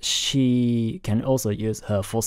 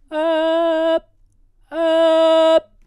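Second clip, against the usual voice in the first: loud high belted chest note; chest voice demo; 7 dB louder than usual. Shown as a demonstration. Vocal coach showing the same note in chest voice. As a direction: push the note out with full chest. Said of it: "with my chest voice, it sounds like this".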